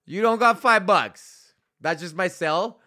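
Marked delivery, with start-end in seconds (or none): 0.1-1.5 louder, slower pushy pitch; mock aggressive sales close; mock hard sell. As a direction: lean on the listener like a pushy salesman. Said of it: "that's my hard close".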